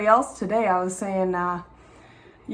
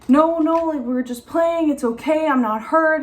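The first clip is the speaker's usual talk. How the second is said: put-on higher, louder kid protesting; imitating her son, higher kid voice; Levon's voice; 6 semitones higher, 4 dB louder than usual. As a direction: insist like a little boy caught playing. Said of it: "He's like".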